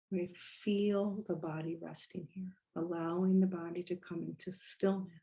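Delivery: unhurried body-scan guidance with long pauses; guided meditation. Real, not acted. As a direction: gently lead them into stillness, letting phrases settle.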